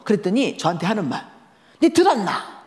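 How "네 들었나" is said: high, louder demanding question; mother questioning daughter, irritated. Retold or imitated. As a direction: challenge her sharply.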